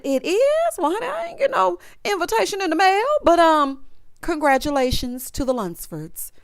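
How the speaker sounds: high, mock-innocent surprise; sassy fake surprise. Ex-wife feigning surprise at the wedding news, high and shady. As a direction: play it sweetly shady. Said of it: "She said".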